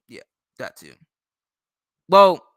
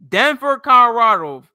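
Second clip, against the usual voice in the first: mocking scorn; 7 semitones higher, 9 dB louder than usual. Real, not acted. Mocking a Denver commenter.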